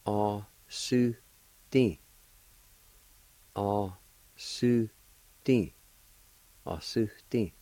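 slow, careful teaching pace; slow and clear; slow demo. Shown as a demonstration. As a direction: draw the word out slowly for learners. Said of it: "It's pronounced like this".

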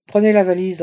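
loud firm command; ordering. A narrator's reading. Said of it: "ordonna-t-il".

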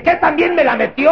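high, loud startled question; alarmed squeak. Retold or imitated. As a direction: shriek it in shock. Alarmed patient quoting himself. (pregunta alarmada aguda y fuerte)

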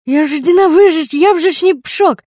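muffled through a hand, high; muffled cry. A narrator's reading. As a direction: cry out through covered mouth. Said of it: "закрыла ей рот рукой". (приглушённый крик сквозь ладонь)

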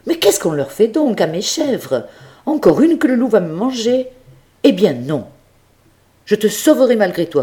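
fussing master deciding to lock her in; exasperated old farmer. Acted. Farmer exasperated, locks goat up. (monsieur Seguin exaspéré, très aigu, de plus en plus rapide)

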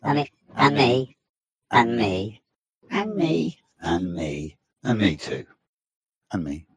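shifting pitch voice changer; voice morpher. Shown as a demonstration. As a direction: test the voice changer settings. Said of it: "my voice morpher on".

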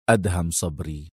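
low, soft, resolute answer; firm. A narrator's reading. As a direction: state it with quiet firmness. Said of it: "مجيبا في حزم".

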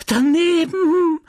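wife shrieking in horror at her husband's words. Acted. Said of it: "kreischte Madame Homais".

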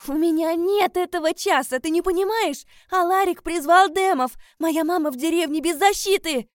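high, loud, frantic outburst; desperate shouting. Acted. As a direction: shout in panic for a mother in danger. Heroine pleads as village is threatened.

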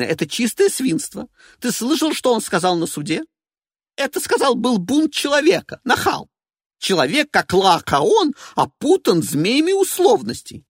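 high, loud and indignant; an outraged woman quoting; shrill diva voice. Acted. Evelina voiced.

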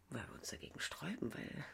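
breathless secret whisper; hushed lovers' whisper. Acted. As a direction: whisper it close to his ear. Intimate confession between lovers.

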